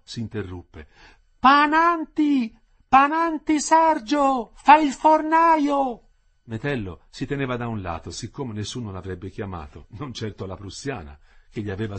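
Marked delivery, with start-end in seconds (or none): 1.4-6.0 high, drawn-out loud call; woman shouting out. A narrator's reading. Prisoner's wife calling for someone's mother, shouting. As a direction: stretch the name in a full-voiced yell. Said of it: "tornò a gridare la moglie".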